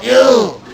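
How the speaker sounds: Ew squeezed through a nearly closed mouth. Shown as a demonstration. Instructor showing students the wrong yell, closed-mouth Ew. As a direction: demonstrate Ew with the mouth held shut. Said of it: "You can't say it with your mouth closed".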